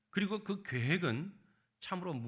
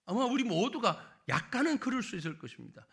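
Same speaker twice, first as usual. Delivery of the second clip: ordinary talk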